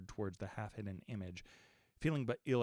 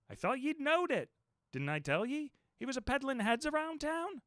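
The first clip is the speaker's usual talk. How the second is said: landlord's voice; 13 semitones higher than usual. A narrator's reading. The landlord calms the frightened guest, grinning. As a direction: reassure him with a grin.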